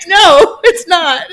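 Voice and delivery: loud, high, laughing no; amused denial. Retold or imitated. Caller admitting it is no emergency, amused denial. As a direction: deny it emphatically through laughter. Laughing retort in retold phone call.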